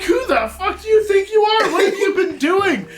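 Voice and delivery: high, loud scolding creature voice; angry pterodactyl. Acted. Pterodactyl yelling at the reckless man. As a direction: scold him like an outraged flying beast.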